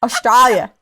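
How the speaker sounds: country name said the Aussie way; Australian accent. Shown as a demonstration. Guest doing accents by place name, Australian accent. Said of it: "I can only do the accent of the name".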